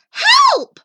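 very high, loud shriek; bellowed cry for help. Acted. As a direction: bellow it out in desperation. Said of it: "She bellowed".

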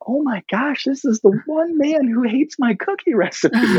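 very high, panicked inner monologue; mock dread. Speaker fearing a man hates the cookies, high mock dread. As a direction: play up the comic alarm in a high voice. Comic dread at man's question.

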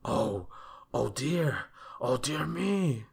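rising, drawn-out lament; wailing. A narrator's reading. Mister Mean losing his money to potatoes, wailing. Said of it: "he wailed".

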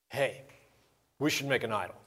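usual speaking voice

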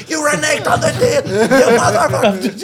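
high, laughing accusation; a hammed-up cry of betrayal; laughing mock outrage. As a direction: play the betrayed brother through giggles. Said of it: "He's like".

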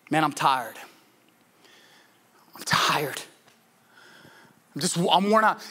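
low, weary sigh of a line; tired, worn out. Acted. Preacher voicing what a heavy heart says, tired. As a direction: say it drained, dropping low. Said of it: "the second thing that, that we might say".

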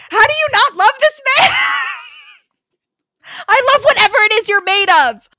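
high, loud, laughing gush; squealing delight. Real, not acted. Fan gushing over the love interest, squealing. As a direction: swoon out loud. Swooning over the book hero.

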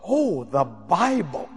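slow, low mock realization; imitated stewardess catching on slowly; stewardess realizing. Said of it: "She goes".

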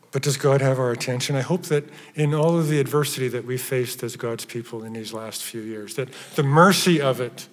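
ordinary talk, nothing marked

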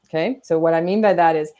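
normal talking voice

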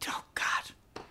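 breathless whisper of dawning dread; hushed whisper. Retold or imitated. Veteran whispering to himself as she searches, hushed. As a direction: whisper it to yourself, stunned. Inner reaction at the wall.

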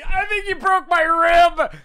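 slow, high, loud mock pained wheeze; short of breath. Injured brother telling him his rib is broken, mock breathless. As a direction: struggle for air after a hit. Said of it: "having trouble breathing".

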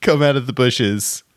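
ordinary talk, nothing marked

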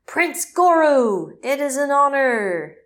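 loud, slow villain proclamation; shouting. Retold or imitated. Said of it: "the baddie shouts".

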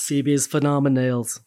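"Phoebe's Phenomenals" drawn out slowly in a put-on character voice. Echoing the host's intro, slow drawn-out character voice.

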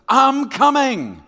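drawn-out high loud singsong; playing a wife who is running late, calling back in a drawn-out loud whine; mock irritated wife. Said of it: "Here's her translation when she says".

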